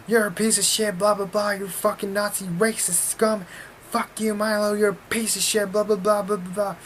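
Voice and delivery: high-pitched tirade, imitated; mock ranting protester. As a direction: rattle off insults like a high-voiced heckler. Imitating second protester's angry rant.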